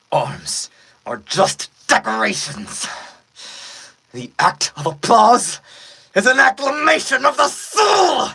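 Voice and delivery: loud, mounting villain rant; villain's shouted proclamation. Acted. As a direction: climb to a full yell. Beat-up villain rising to a shout.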